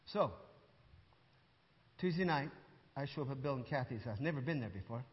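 ordinary talk, nothing marked

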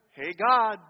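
offhand, relaxed "Hey, God." said coolly like greeting a buddy; casual calm greeting. Shown as a demonstration. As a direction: toss off "Hey, God." without urgency. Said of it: "it's not just this kind of calm, cool, collected".

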